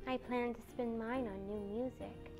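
very soft, small voice; shy, hushed wish; little sigh. A narrator's reading. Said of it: "with a little sigh".

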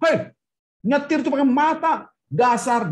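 high-pitched mock yell at a bad driver; imitated indignant street shout; angry road heckler. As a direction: scold a careless driver, voice jumping up. Acting an angry road user's insult.

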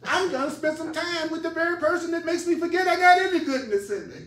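high, loud, hammed-up protest; exaggerated outrage with a laugh at the edges; mock indignation. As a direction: complain comically, pitch way up. Acts busy American objecting to stillness.

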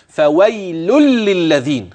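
"فويل للذين" slowly, each l sounded; successive l's. Shown as a demonstration. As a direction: pronounce the run of l's in "فويل للذين" clearly. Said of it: "خلي بالك من توالي اللامات".

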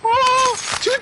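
surprised shriek. Real, not acted. Surprised at going to church.

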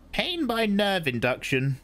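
put-on high, measured old woman's voice; old Reverend Mother. A narrator's reading. Old woman explains the box to Paul, high and measured. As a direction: speak as the stern old crone.

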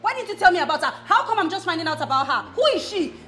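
loud, rapid-fire accusing questions; angry questioning. Acted. Wife grilling her husband about the girl, loud and angry. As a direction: demand answers from him.

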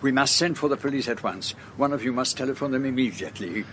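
fast, urgent instructions; urgent orders. Acted. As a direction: rush the orders out in alarm. Ordering police called at once.

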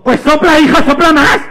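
high, loud yelled order; exasperated mother. Acted. Mother answering screaming María, exasperated high shout. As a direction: shout back like a fed-up mother. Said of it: "la madre le dijo".

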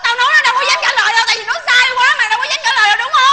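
heated, rapid rebuke; angry scolding. Acted. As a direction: tell him off hard and quick. Friends quarrel over dropped rice.

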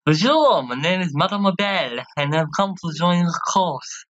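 put-on lilting French accent, drawn out at the end; theatrical French-accented greeting. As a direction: introduce yourself in a French accent. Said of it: "doing this lovely French accent".

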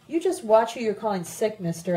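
plain reading voice

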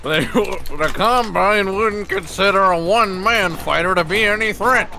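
a country bumpkin giving orders. Acted. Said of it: "now you're a little bit too hickish".

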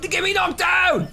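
very high, loud yell; real high-pitched gamer yell; urgent shout. Real, not acted. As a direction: shout it out, stretching it the second time. Calling out urgently in the game.

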